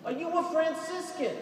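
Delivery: put-on an old Long Island woman calling to the friar, in a high Long Island accent. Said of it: "That's a Long Island accent".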